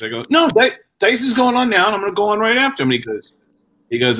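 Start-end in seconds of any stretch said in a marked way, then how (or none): 0.3-2.9 high, rushing objection; insistent protest. Retold or imitated. Young comic insisting he follows the headliner, high protest. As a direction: argue back urgently in a raised pitch. Arguing over going on stage.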